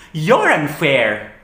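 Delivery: loud, slow, falling retort; angry accusation. Acted. Turning the accusation on partner, angrily accusing. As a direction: hurl it back, drawn out and emphatic.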